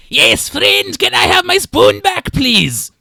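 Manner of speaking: very high, loud eager creature voice; pleading lizard man. Acted. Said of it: "his hands outstretched just make grabbing motions".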